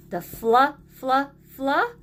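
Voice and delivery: fla, fla, fla stressed and repeated; sounding out blend. Shown as a demonstration. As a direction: break the blend fla apart. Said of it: "and the Y at the end".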